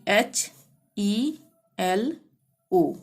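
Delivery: letters H E L read out slowly one by one; teacher spelling out hello letter by letter. Shown as a demonstration. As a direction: dictate the letters to the class. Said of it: "hello को यहाँ पे लिख सकते थे".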